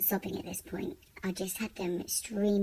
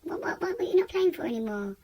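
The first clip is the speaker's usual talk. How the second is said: concerned carer; 9 semitones higher, 4 dB louder than usual. Retold or imitated. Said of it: "saying to me".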